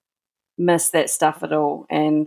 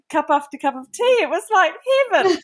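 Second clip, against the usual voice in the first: delighted; 11 semitones higher than usual. Real, not acted. Joyful memory of tea.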